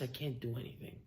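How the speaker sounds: very soft, low murmur; hushed helpless reply. Retold or imitated. Elisha turning down the pleading widow, hushed and helpless. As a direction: let it drop almost to nothing, resigned.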